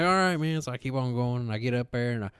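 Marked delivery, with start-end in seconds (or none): 0.0-0.6 mock casual reply.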